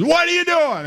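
high shouted question out the window; hollering. Retold or imitated. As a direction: yell it from the window. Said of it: "he hollers out the window".

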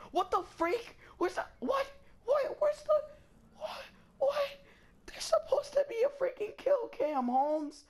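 very high squealed protest; shrieked disbelief. Real, not acted. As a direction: squeal it in baffled outrage. Outraged that kill cam is missing.

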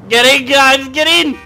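high, loud holler to passengers; loud, urgent summons; urgent calling out. Pretend. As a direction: shout them into the car. Calling characters into the car.

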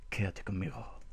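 very soft, eerie invitation; hushed character voice. Acted. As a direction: murmur it like a mysterious figure. Voices mysterious figure calling.

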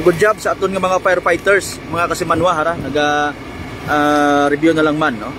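ordinary delivery

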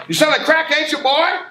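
loud, high accusing shout; mock teacher yelling at a student. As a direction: bark the accusation at him.

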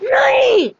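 sudden high yell of no; high shouted no; anguished shout. Real, not acted. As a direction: cry out as the killer is revealed. Player shouts at being killed.

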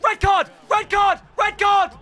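high, repeated shout; insistent appeal. Real, not acted. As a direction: demand it from the referee. Appeals for red card.

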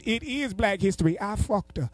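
high put-on uncle retort; insisting uncle. Uncle insists to his nephew, mock high protest.

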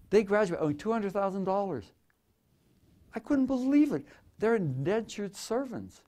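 high, incredulous outburst; shocked disbelief. Real, not acted. Incredulous outrage at student debt.

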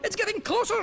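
high, loud panicked shout. Acted. Someone in the crowd warning everyone, high panicked shout. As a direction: yell the warning at full alarm. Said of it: "shouted someone".

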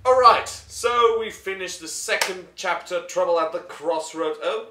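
loud upbeat announcement; excited announcement. Real, not acted. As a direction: proclaim it with energy. Announcing chapter finished.

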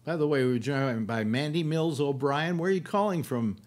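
normal talking voice

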